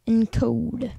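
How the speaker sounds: normal talking voice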